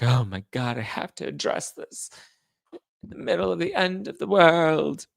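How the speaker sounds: hammed-up distress, rising high at the end; mock overdramatic. Host mocking someone addressing a trivial matter, overdramatic. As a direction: overplay the drama, climbing in pitch.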